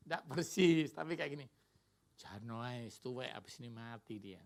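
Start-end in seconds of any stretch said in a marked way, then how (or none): 2.1-4.4 low, hushed tempting voice of the devil; the devil whispering to a child about aging parents; devil's whisper. Pretend. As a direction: murmur it low like a devil in the ear. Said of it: "pasti ada suara gini".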